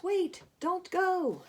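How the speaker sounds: high, very slow, drawn-out plea; creepy pleading echo. Acted. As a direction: lure him back, eerie and slow. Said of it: "the voice down in the well goes".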